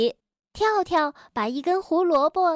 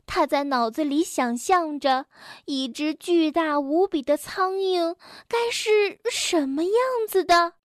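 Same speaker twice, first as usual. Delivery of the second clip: ordinary delivery, nothing marked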